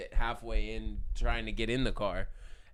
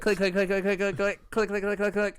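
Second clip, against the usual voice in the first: rapid-fire high clicking words; loud, pitched-up mimicked camera burst; camera clicks; 7 semitones higher, 5 dB louder than usual. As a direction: rattle off shutter clicks like a burst of photos. Imitates rapid phone camera shutter.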